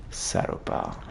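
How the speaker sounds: low unvoiced hiss of an insult; whispered curse. Real, not acted. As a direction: whisper it with contempt.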